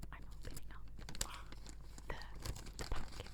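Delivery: breathy unvoiced whisper; ASMR whisper. Shown as a demonstration. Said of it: "You barely need to be talking".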